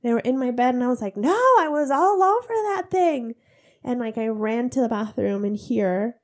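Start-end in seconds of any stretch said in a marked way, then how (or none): 1.2-3.4 alarmed shout. Retold or imitated. Alarmed cry at kids.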